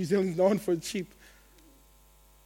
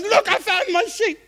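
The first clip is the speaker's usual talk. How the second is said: much higher and louder, triumphant cry; joyful finding; 10 semitones higher, 7 dB louder than usual. Acted. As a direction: shout it like a shepherd who found his lamb. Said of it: "he puts it on his shoulders, and he goes".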